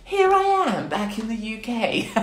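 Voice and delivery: high, loud mock-grand announcement; mock triumphant return. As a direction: sing out a hammed-up arrival. Imagined caption, mock grand announcement.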